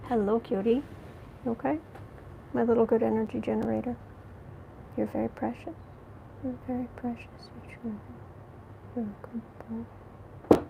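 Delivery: soft, hushed cooing to a pet. Real, not acted. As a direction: coo sweetly to the little dog.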